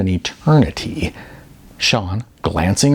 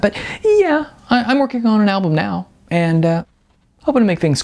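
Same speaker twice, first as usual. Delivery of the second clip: eager; 9 semitones higher than usual. Acted. Sean eager about his music.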